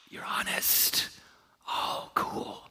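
whispered, unhurried reassurance; gentle acceptance. Acted. The Lord answering an honest confession, gentle acceptance. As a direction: breathe it out softly, with quiet wonder.

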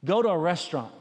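usual speaking voice

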